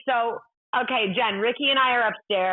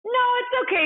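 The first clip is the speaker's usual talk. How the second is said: much higher, bashful no; coy refusal; 13 semitones higher, faster than usual. Retold or imitated. She shyly declines star's photo, much higher. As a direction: play shy. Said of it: "I went".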